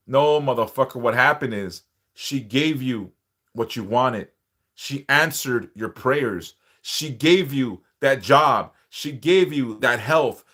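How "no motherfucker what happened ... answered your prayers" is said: normal talking voice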